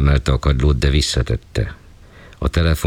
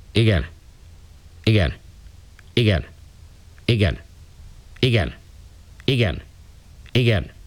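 higher, clipped yeses to a superior; obedient yes on phone; 7 semitones higher, 3 dB quieter, slower than usual. Acted. Acknowledging orders on phone.

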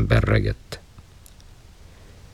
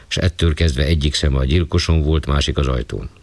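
plain reading voice

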